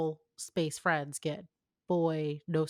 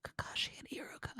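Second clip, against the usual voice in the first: dramatic hushed whisper; conspiratorial whispered reveal of a ship; 9 dB quieter than usual. Acted.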